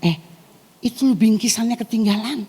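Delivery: a hint of a tempting devil calling you back; devil's voice. Devil calling the believer back at the door, lightly voiced sly tempter. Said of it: "iblis bilang gini".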